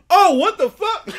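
very high, very loud retold scream; panicked yell. Man being seized and pulled into a van, high panicked mock yell. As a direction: imitate someone suddenly grabbed. Man grabbed by two strangers.

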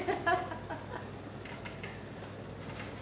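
breathy rising laugh that falls away; embarrassed laugh. Real, not acted. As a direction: laugh off the compliment. Laughs at herself after joking.

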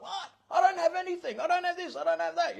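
mock panic from a caught-out wife; panicked wife. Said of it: "She's like".

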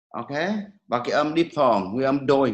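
ordinary delivery

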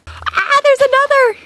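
high, loud thrilled cry; excited. Real, not acted. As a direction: squeal at the new find. Spotting another cat.

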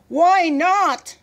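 high, loud challenge; sharp demand. A narrator's reading. General questioning his gunner, demanding. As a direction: demand an explanation loudly.

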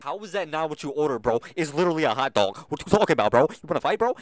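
loud, hammed-up tirade at a customer; playfully furious shouting at a game customer; mock angry yell. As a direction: yell at him like you want to fight. Yelling at a complaining customer.

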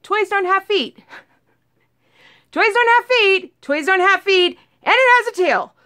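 high, stunned repeated realization; rising alarm at what the cat caught; horrified realization. Retold or imitated. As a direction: it dawns on her what it really is.

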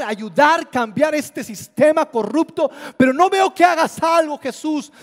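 high, loud accusing cries at Jesus; the angry crowd demanding that Jesus save them. Retold or imitated. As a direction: shout at him to finally do something.

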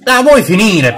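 high, loud outburst; exasperated shout. Real, not acted. Player fed up with a game enemy, exasperated shout. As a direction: yell at a pest to stop.

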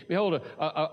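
ordinary talk, nothing marked

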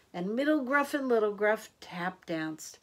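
ordinary delivery, nothing marked

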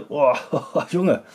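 laughing through the chili burn; mouth burning from spicy food. Real, not acted. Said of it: "nur ein kleines Stückchen abgebissen".

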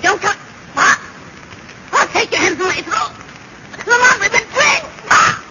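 loud urgent shout. Acted. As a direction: shout after him in alarm. Tricked, calling after Pops.

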